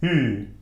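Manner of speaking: "Ü" as ee through forward lips; rounded, isolated "Ü"; French Ü sound. Shown as a demonstration. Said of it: "This sound we can use also in French".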